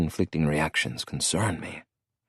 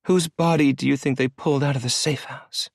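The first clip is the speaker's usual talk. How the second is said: haunting smile; 8 semitones higher than usual. A narrator's reading. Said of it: "A haunting smile curved her face".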